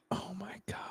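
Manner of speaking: breathless whispered gasp of shock. Real, not acted. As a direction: whisper it in disbelief. Shocked gasp at lost Bitcoin.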